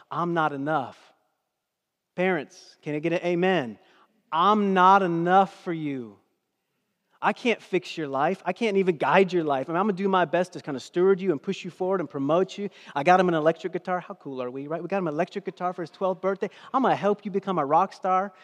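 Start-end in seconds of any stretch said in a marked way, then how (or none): 2.2-3.8 loud, driving sermon delivery; preaching. Real, not acted. Preaching at full force. 4.3-14.0 loud confession. Pretend. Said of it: "you say to yourself".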